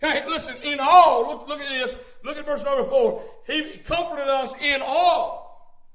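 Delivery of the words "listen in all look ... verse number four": ordinary delivery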